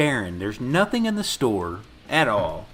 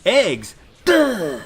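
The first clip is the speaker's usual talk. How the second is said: mock dopey kid, higher and louder; 9 semitones higher, 7 dB louder than usual. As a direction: blurt it like a dim kid. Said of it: "when he said".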